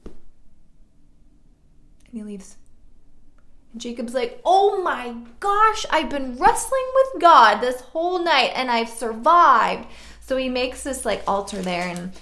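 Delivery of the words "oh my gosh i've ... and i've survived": loud, high mock amazement; astonished exclamation. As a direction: exclaim it big, stretching the first words. Jacob realizes he wrestled God.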